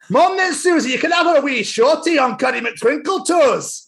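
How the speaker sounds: put-on high Scots child voice, breaking into a laugh; Peppa in Scottish. Reading Peppa book to friend, high Scottish Peppa laughing. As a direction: read the cheeky line in broad Scots. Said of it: "I'm gonna do it in my accent".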